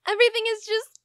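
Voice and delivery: distraught crying. Acted. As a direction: wail it out through tears. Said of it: "Calm down".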